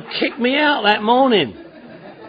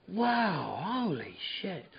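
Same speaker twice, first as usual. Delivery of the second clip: very soft, slow, breathless wonder; hushed stunned holy shit; awed hush; 3 semitones lower, 15 dB quieter, much slower than usual. Retold or imitated. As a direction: barely breathe it out in awe. Awed whisper at the room.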